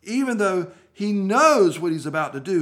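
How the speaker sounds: ordinary talk, nothing marked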